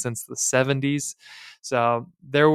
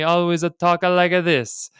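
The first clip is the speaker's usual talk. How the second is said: exaggerated Italian accent, higher and louder; Italian accent impression; 5 semitones higher, 4 dB louder than usual. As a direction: ham up a thick Italian accent like a caricature. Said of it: "this Italian guy with a crazy Italian accent".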